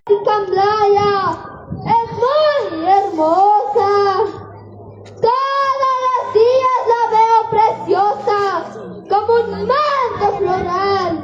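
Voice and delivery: earnest school declamation; poem recitation. Acted. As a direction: recite it proudly to classmates.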